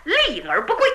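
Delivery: usual speaking voice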